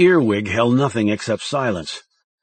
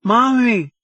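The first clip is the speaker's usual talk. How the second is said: put-on high, frightened little boy calling out; a scared child crying for his mother; frightened small boy's cry; fearful child; 12 semitones higher, 5 dB louder than usual. A narrator's reading. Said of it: "said the boy in a fearful tone".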